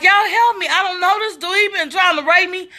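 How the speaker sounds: high-pitched pleading cry for help; frantic plea. Retold or imitated. Begging men at gas station.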